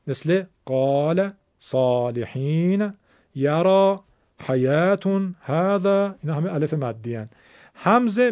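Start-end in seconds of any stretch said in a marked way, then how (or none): 0.1-5.3 slow, with each long alif drawn out in قال and صالحین; long alif examples. Shown as a demonstration. Teacher showing students the long alif, drawn out slowly. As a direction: stretch the alif in یرا and حیاتون. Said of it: "صدای کشیدهٔ بالاست".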